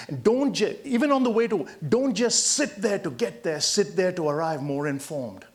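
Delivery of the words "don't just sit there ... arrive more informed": ordinary talk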